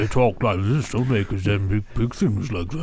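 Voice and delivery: lumbering put-on elephant voice; big elephant voice. Shown as a demonstration. Showing a co-host how big elephants talk, big elephant voice. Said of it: "Elephants don't talk like that".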